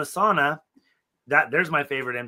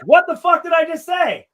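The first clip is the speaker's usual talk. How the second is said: loud, high-pitched exasperated outburst; angry snap; 8 dB louder than usual. Retold or imitated. Manager catching a coworker gossiping after a warning, angry snap. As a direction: snap at someone who ignored your warning. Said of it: "I snapped at her bad".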